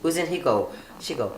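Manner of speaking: ordinary talk, nothing marked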